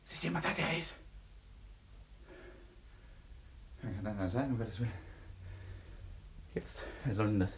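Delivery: a frightened hush after a knock. Acted. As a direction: drop the voice, someone's at the door.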